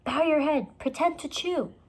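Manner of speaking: high, urgent instruction; high-pitched urgent directions. A narrator's reading. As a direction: tell her fast what to do. Urgent instruction near dinosaur.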